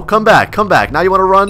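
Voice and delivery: ordinary delivery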